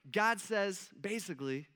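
ordinary talk

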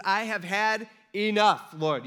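slow, loud, fed-up plea; acted despair. Preacher voices Elijah at breaking point with God, acted despair. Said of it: "Talk about depressed".

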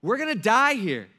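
loud, high panicked plea; freaking out. Retold or imitated. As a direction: imitate frantic men begging to be saved. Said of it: "the disciples are freaking out".

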